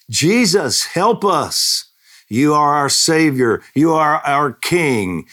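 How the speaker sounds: very high, loud pleading prayer; desperate cry to Jesus. Retold or imitated. Woman crying out to Jesus in the storm, desperate loud plea. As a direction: cry out to God in terror. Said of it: "my voice cried".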